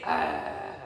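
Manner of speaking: playful drawn-out wolf noise; wolf growl. Shown as a demonstration. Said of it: "The wolf say".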